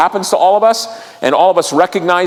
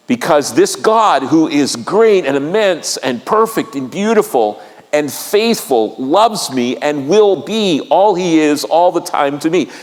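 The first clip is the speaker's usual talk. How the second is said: ordinary delivery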